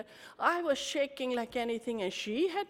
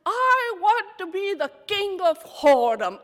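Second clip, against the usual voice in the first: much higher and louder, every word pressed; emphatic repeat; 9 semitones higher, 10 dB louder than usual. Retold or imitated. Repeating the wish to the anchor, loud and high. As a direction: belt it out to make her hear.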